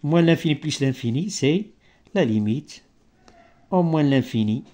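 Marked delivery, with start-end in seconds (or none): none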